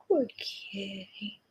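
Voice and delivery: whispered praise. Real, not acted. Cooing to pet, whispered take.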